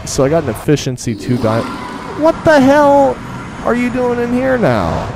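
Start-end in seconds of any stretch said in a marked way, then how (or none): 2.2-5.1 very high, loud, startled shriek. Real, not acted. Gamer shrieking at a mob that appears beside him. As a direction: yelp at the sudden intruder. Mob suddenly inside the portal.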